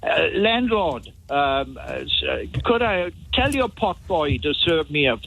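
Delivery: a light, mock-pompous posh English accent ordering at the bar. Visitor mocking how an English guest orders ale in a Welsh pub, mock-posh English accent. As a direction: ham up a refined English gent asking the landlord for ale. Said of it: "in your usual accent".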